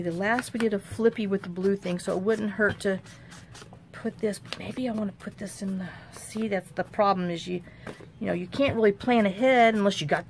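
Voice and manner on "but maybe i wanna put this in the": ordinary talk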